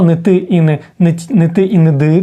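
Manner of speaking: normal talking voice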